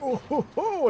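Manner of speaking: put-on high, hyper old-man chuckle. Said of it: "a very old man hyper voice".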